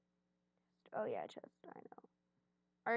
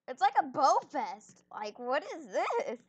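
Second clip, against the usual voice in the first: exasperated; 6 dB louder than usual. Real, not acted. Exasperated at bow fest.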